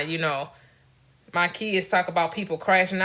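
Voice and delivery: ordinary talk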